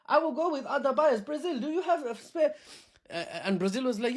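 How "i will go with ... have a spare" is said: much higher, playful mock voice; voicing China. China shrugging off American soybeans, high playful mock voice. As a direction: play a breezy, unbothered China. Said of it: "China was like".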